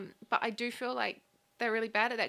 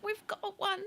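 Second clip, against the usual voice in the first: mock exasperation; 12 semitones higher than usual. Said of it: "I'm like".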